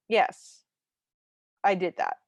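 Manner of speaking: halting, lightly faked agreement; playing along poorly. Bev covering her slip to Luke, playing along poorly. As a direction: confirm it unconvincingly, with pauses. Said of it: "playing along extremely poorly".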